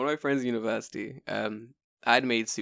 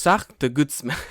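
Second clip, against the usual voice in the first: laughing mock French accent, louder; slow, giggly fake French; playful mock French accent; 3 semitones higher, 9 dB louder, slower than usual. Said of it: "sorry for my French accent".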